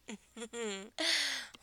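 drawn-out humming laugh; throaty-closed chuckle. Pretend. Dominant amused by a teasing partner, humming chuckle.

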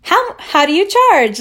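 high, loud put-on greeting; mock awkward approach. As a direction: fake an overeager cold message. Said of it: "That would be really weird".

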